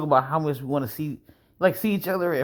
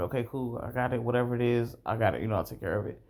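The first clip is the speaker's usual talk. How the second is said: easygoing; 5 semitones lower, 6 dB quieter than usual. Retold or imitated. Offering to pay, calm.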